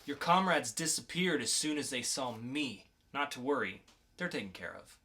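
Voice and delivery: lightly voiced calm interrogator, cool and in control. Podcast host reads the mysterious agent to a captured teen, cool and controlled.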